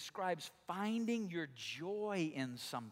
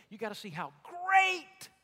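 excited; 7 semitones higher, 6 dB louder than usual. Acted.

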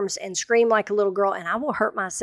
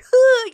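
loud, high-pitched gasp; imitated choking gasp; 8 dB louder than usual. Imitating husband choking with laughter, airless gasp. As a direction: mimic someone laughing too hard to breathe. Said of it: "he can't get air in anymore".

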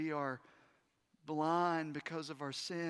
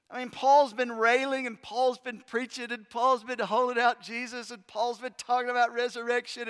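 loud, higher, building emphasis; preaching crescendo; 4 semitones higher, 8 dB louder than usual. Real, not acted. Preacher building to a climax, loud and rising. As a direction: drive each line harder.

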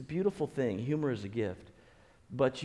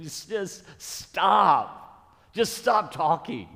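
loud, high, drawn-out plea to stop; fed-up parent raising the voice; exasperated parent; 5 semitones higher, 7 dB louder, much slower than usual. Acted. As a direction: cut into the kids' bickering, voice raised and stretched. Parent interrupting bickering kids, acted.

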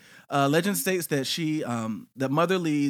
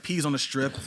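ordinary talk, nothing marked

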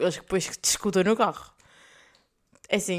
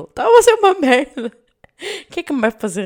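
much higher, louder dramatic complaint; much higher, louder mock-dramatic complaint; mock wail; 7 semitones higher, 8 dB louder than usual. Real, not acted. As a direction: overplay how awful the day was. Exaggerated complaint about her day.

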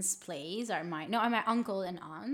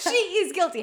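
higher, louder joking judgment through laughter; a hammed-up accusation; mock verdict laughing; 8 semitones higher, 7 dB louder, faster than usual. Host laughing at her childhood self, mock verdict.

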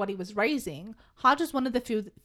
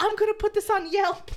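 entitled customer; 8 semitones higher, 4 dB louder than usual. Retold or imitated. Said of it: "Imagining all these women".

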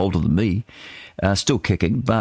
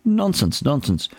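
scoffing denial; 7 semitones higher, slower than usual. Retold or imitated. Scoffing at the accusation.

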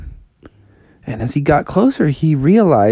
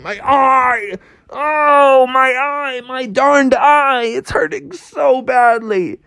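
Froggy moaning in pain; 10 semitones higher, slower than usual. Acted. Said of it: "Froggy was lying there saying".

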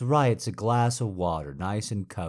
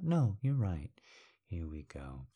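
ordinary talk, nothing marked

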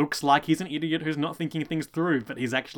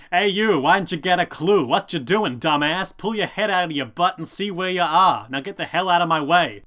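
loud, raised, ranting taxi driver; angry cabbie; 5 semitones higher, 7 dB louder than usual. Retold or imitated. Taxi driver yelling at Jojo, loud angry rant. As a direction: yell at the pedestrian like a furious cabbie.

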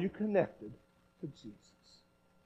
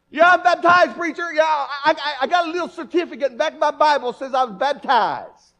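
imitating a smug churchgoer, louder and higher; mock churchgoer; 6 semitones higher, 8 dB louder than usual. Preacher mimics a congregant answering him, mock proud. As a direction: play the self-satisfied member boasting. Congregant voiced defending himself.

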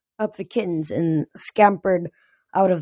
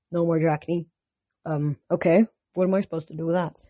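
usual speaking voice

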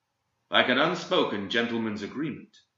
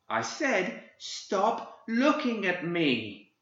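put-on high, slow, seething child's voice; in rage; 10 semitones higher, much slower than usual. Boy turning on the old man, seething with rage. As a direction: hold the fury in, menacing not screaming. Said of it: "The boy turned upon him in rage".

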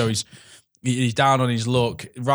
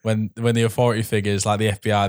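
ordinary talk, nothing marked

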